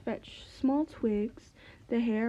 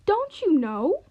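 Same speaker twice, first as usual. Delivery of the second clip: higher, louder surprised call; 4 semitones higher, 6 dB louder than usual. Acted. Magpie calling out to the puzzled owl. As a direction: call it out in disbelief. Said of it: "calls the magpie".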